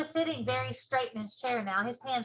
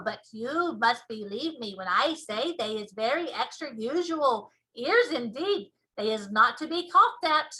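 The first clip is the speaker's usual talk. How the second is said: BFG giant voice; 7 dB louder than usual. Acted. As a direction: show off the ears proudly. BFG defends ears.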